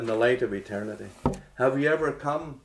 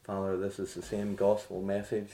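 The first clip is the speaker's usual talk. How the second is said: soft, lower prayer voice; 4 semitones lower, 5 dB quieter than usual. Acted. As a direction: pray quietly to the Father.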